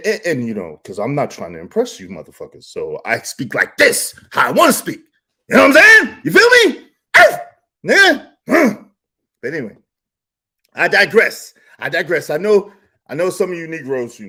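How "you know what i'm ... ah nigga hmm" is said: high, loud hyped call-out; hyped shout. Real, not acted. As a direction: fire up the crowd. Hyped rant, calling out.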